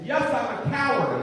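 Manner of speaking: high, slow, emphatic declaration; loud admission. Acted. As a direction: proclaim the cowardice loudly. Acted proud admission to cyclist.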